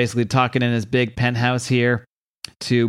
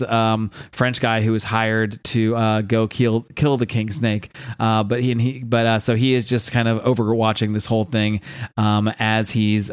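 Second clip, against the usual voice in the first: normal talking voice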